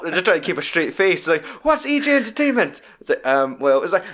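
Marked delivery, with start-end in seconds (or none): 1.6-2.8 high indignant question; mock rant. Film dad grilling the naughty schoolboys, mock outrage. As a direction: imitate a dad demanding an answer. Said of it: "it's his fucking rant".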